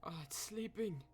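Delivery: usual speaking voice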